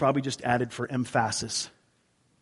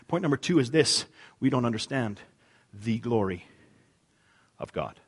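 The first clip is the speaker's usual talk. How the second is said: normal talking voice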